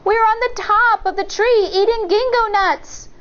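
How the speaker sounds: high, lightly voiced little girl; a bright child teasing from above; child's voice. A narrator's reading. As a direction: Shang teases the wolf, a high child voice. Child answers from the tree.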